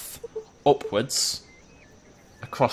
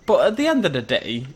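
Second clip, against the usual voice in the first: normal talking voice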